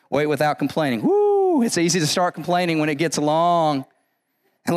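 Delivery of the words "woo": woo. Real, not acted. Reaction after wait without complaining.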